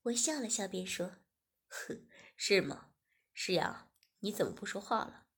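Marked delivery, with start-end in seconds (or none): 1.7-5.3 lower, steady man's voice for 文轩; male lead's voice. Acted. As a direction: speak as the calm young boss. Narrator voices male lead. (低沉的男主角声音)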